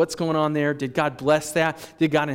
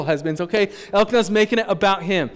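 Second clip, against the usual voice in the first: ordinary talk, nothing marked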